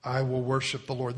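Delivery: usual speaking voice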